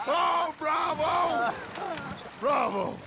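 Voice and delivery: cheering. Real, not acted. Applauding the song.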